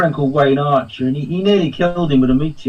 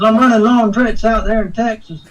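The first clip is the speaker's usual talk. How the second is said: higher Texas twang, chatty; Texas accent; 8 semitones higher than usual. Retold or imitated. Quoting the actor's Texas talk, in a put-on Texas accent. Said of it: "That's how he spoke".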